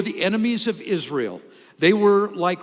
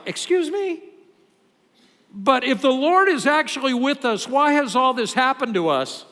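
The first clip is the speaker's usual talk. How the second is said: incredulous; 7 semitones higher, 5 dB louder than usual. Retold or imitated. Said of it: "It's like".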